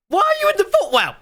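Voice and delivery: high-pitched exasperated repeat; exasperated disbelief. Real, not acted. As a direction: ask it again in total disbelief. Streamer exasperated at story.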